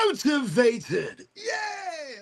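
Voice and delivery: high, drawn-out cheer; rallying cheer. Pretend. Streamer rallying everybody watching, high playful cheer. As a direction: shout it slowly, then a squeaky high yay.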